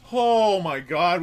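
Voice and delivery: slow, loud relieved gasp of words; drawn out with relief. Real, not acted. Relief after surviving fight.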